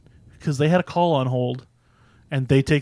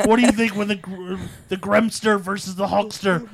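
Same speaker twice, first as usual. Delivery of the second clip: Hulk Hogan impression; 6 semitones higher than usual. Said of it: "He's like".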